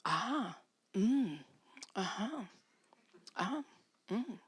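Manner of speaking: soft murmured uh-huhs; listening noises. Shown as a demonstration. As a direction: show how a husband just listens. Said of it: "Your only job is to do this".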